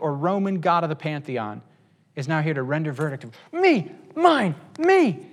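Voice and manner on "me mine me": put-on high, loud squabble of grabby kids; whiny high-pitched bickering; childish squabbling. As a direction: shout mine like a selfish child. Repeats the selfish voice.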